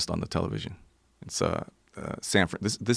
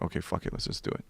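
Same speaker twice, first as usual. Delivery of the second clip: barely voiced, much softer; whispered agreement; 11 dB quieter, faster than usual. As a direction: give in under your breath.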